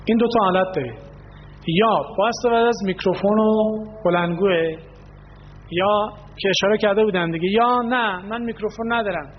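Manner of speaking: ordinary delivery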